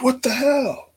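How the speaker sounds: squeaky high mock wife outburst; mock Stephanie. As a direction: play Stephanie shrieking in disbelief. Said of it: "She's like".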